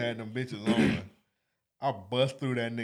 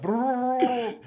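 high, rolling brrr; shivering fish trill; 12 semitones higher, 5 dB louder than usual. Retold or imitated. Fish shaking in the hot tank, high trill.

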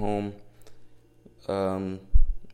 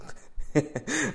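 high laugh; 13 semitones higher than usual. Real, not acted. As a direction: burst of laughter. Laughs at being alone.

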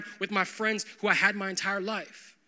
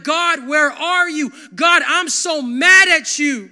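slow, much louder, higher cry at God; mock angry prayer; 6 semitones higher, 13 dB louder, slower than usual. Pastor acting out a furious prayer, slow and loud. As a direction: cry out your frustration at God. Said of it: "if you're like".

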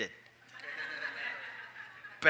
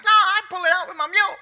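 country farmer; 13 semitones higher, 8 dB louder, faster than usual. Retold or imitated. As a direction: play the easygoing mule farmer. Farmer voiced again.

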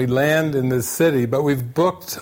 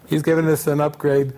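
ordinary delivery

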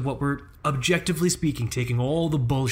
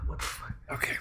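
whispered; 9 dB quieter, faster than usual. Real, not acted. Muttering after coughing fit.

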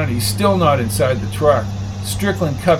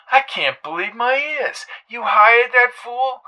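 Coco in disbelief; 9 semitones higher than usual. A narrator's reading. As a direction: drag out the disbelief.

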